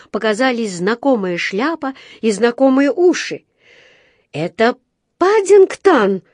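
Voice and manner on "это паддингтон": name flung out very high and loud; high, loud, stretched recognition; excited recognition. A narrator's reading. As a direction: cry out in joyful shock. Paddington found under the wreckage.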